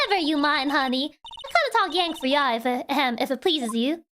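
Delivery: put-on Southern accent. Photographer reassuring the shy girl, in a Southern accent. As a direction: soothe her in a Southern accent. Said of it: "see pull it off correctly".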